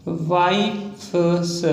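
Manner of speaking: stretched out very slowly, syllable by syllable; wives stretched long and slow as if dictating; slow dictation. Shown as a demonstration. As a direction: drag the word out while writing it. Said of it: "drawn out while writing".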